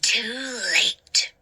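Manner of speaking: low taunting whisper; ghostly whisper. Acted. The ghost mocking Hannah, low taunting whisper. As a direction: breathe it out mockingly.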